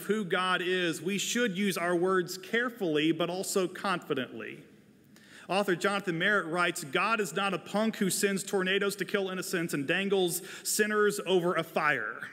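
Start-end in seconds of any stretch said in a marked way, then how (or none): none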